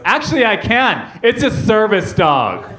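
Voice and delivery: high, drawn-out snooty correction; haughty dog owner. Acted. Lady with dog to Starbucks worker, haughty. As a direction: correct her with smug entitlement.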